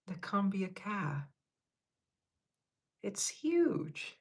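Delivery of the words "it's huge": high, amazed squeal at the size; squeaky disbelief at a big cat; astonished. Real, not acted. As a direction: gasp it out, half shocked.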